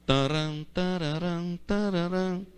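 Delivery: softly hummed phone tune; imitating the phone's little jingle; hummed ringtone jingle. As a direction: hum the collect-call chime. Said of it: "Eu acho tão bonita essa musiquinha".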